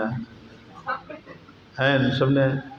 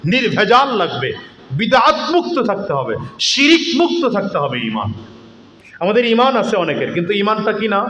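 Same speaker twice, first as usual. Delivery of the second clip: raised, rousing sermon voice with shouted peaks; fiery waz preaching; 6 semitones higher than usual. Acted. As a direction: preach it out to the crowd with rising force.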